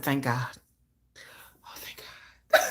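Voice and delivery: whispered relieved thank God. Real, not acted.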